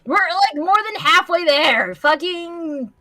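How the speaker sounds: usual speaking voice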